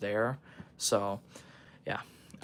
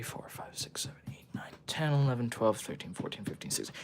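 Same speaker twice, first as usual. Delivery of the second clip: counting under the breath; whispered counting; 9 dB quieter than usual. Real, not acted. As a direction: whisper the numbers to yourself.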